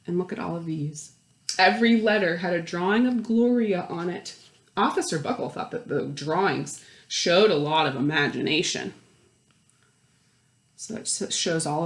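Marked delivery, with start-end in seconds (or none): 1.6-8.9 put-on lively read-aloud voice for children; animated picture-book narrator; storybook narration. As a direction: read it like story time to a class.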